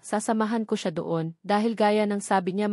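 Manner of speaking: plain reading voice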